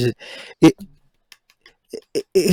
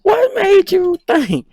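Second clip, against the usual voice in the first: much higher and louder, in mock disbelief; higher, louder exasperated rant, drawn out slowly at the end; 11 semitones higher, 5 dB louder, slower than usual. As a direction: squeak it out, incredulous.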